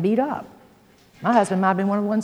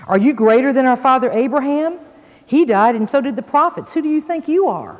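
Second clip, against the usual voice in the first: louder, higher challenging questions; indignant challenge; 5 semitones higher, 6 dB louder than usual. Acted. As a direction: throw it at him as a challenge. Accusers challenge Jesus in scripture reading.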